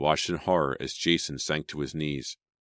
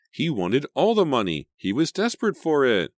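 upset; 10 semitones higher, 6 dB louder than usual. A narrator's reading. Emma upset retelling.

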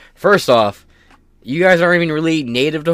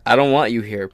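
ordinary talk

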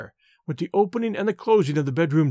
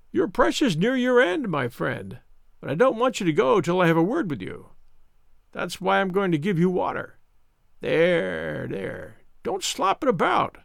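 Mister Smith, smug; 3 semitones higher than usual. Acted. Visitor who sniggered at sick Holmes, smug. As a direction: sneer at the helpless patient. Narrator voices sneering visitor.

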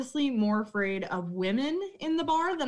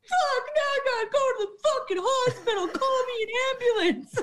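much higher, half-laughing fake sob; mock crying whine; 12 semitones higher than usual. Bartender mimicking a customer with a broken knuckle, mock sobbing. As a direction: wail it like a hurt drunk, laughing through it.

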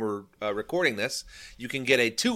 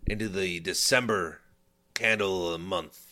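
put-on lower, hammy cowboy accent; 5 semitones lower than usual. Outlaw host riding into candle of the month, cowboy accent. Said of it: "I don't know why I'm talking like this".

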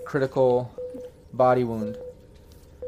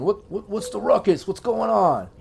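a hint of a higher-voiced tech guy calling out; mechanic yelling; 6 semitones higher than usual. Tech dude stepping out of his room, mildly raised call. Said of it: "uh, yelling".

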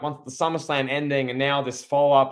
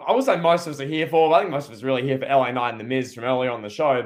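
ordinary delivery

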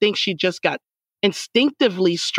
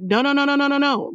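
high-pitched string of imitated no's; mock protest, pitched up; protesting refusal; 8 semitones higher than usual. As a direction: wave it all off in a higher voice, running the words together. Voicing her reluctant refusal.

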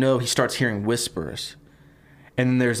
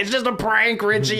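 mocking laugh-off; 10 semitones higher than usual. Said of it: "start laughing at him".

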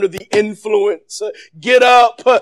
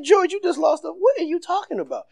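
mock bystander; 10 semitones higher, 4 dB quieter than usual. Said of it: "People think he tripping".

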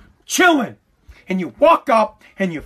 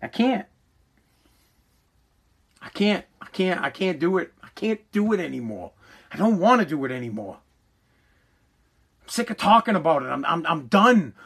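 much lower and softer, worn out; drained resignation; 6 semitones lower, 5 dB quieter than usual. Real, not acted. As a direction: let it fade, giving up. Exhausted giving up after rant.

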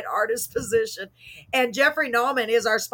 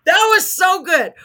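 laughing hard; 4 semitones higher, 7 dB louder, much slower than usual. Real, not acted. Laughing after her own joke.

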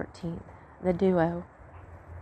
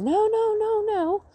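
put-on childish protest; 13 semitones higher than usual. Son protesting being told off.

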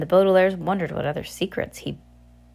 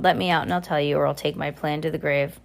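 ordinary delivery, nothing marked

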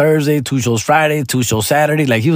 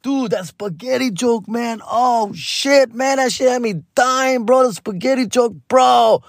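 much higher excited goofy voice; silly Joy Coy; 10 semitones higher, slower than usual. Retold or imitated. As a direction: gush like a giddy fan of the joke. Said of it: "And he tells me".

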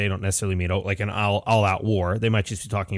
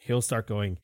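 ordinary talk

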